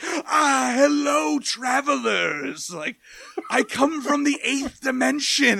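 high theatrical weirdo stretching travelers out; weird crazy Matt Hardy. Retold or imitated. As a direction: greet them like an unhinged visitor from another dimension. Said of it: "he talked, like, in a weird accent all of a sudden".

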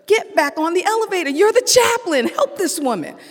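high, urgent voice from above; a commanding voice calling him back, pitched high; high insistent inner voice. Retold or imitated. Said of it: "someone, something said".

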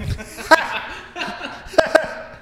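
loud burst of laughter; hearty laugh. Real, not acted. Laughing at teasing joke.

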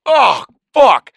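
sudden high, loud cry; startled cry. Retold or imitated. Pigeon bounces off cheek mid-story, startled loud cry. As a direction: break off mid-story at a hit.

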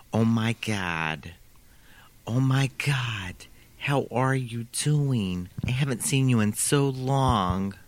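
put-on slow, drawn-out New York accent; imitated New York flight attendant's accent; thickest New York accent. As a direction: gush slowly in a Fran Drescher-like New York accent. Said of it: "almost got the Fran Drescher".

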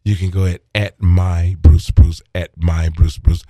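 loud slow handle callout; loud announcement. Acted. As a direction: boom out the name slowly. Plugging his handle.

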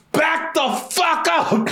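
slow, high, laughing warning to back off; hammed-up bodyguard telling them to back up; playful threat, drawn out and high; mock tough warning. Playing Tom Hanks' defender.

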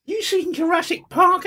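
high, far Essex accent, mocking; imitating Sarah's Essex voice. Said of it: "she talks like this".

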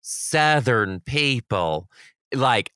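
slowed, drawn-out words; slow drawl. Real, not acted. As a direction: stretch it out deliberately. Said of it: "describing Southern talk".

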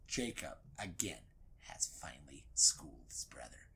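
whispered. A narrator's reading. Dramatic narration hush.

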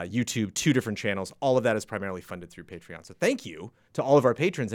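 ordinary delivery

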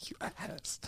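whispered jab. Real, not acted. Friend whispering a jab at the other host. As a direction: breathe the insult under your breath.

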